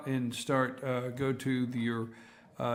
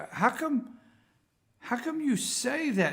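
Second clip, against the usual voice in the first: higher-pitched incredulous asking; retold baffled question; 9 semitones higher than usual. Host retelling his question to a gentleman, baffled. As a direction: put the question again, pitched up in puzzlement. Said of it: "I said".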